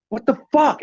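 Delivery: high startled what the fuck; shocked outburst. Retold or imitated. Seeing hair on the menu, high shocked outburst. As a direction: blurt it out in shock.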